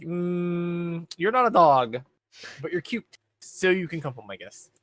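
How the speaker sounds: lightly voiced heroine, charmed despite herself; Fuji giving in. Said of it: "and she's like".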